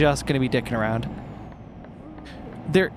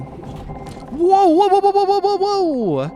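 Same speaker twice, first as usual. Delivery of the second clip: high, loud startled cries; startled whoa; 6 dB louder, slower than usual. Real, not acted. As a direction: caught off guard, yelling whoa. Startled by something in game.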